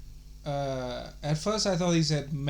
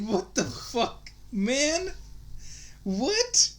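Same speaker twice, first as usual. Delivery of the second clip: shocked disbelief; 8 semitones higher, much slower than usual. Retold or imitated. Shocked retort to caller.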